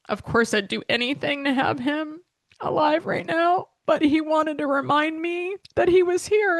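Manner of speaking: holding back tears while talking about her late dad; choked up. Real, not acted. To podcast listeners about her late father, choked up and near tears. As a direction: voice high and tight, near tears. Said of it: "before I start absolutely bawling".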